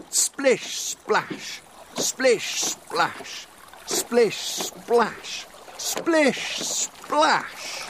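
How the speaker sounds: high, lively splashes; excited splashing. A narrator's reading. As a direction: chant the splashes as they race. Boats racing splashes.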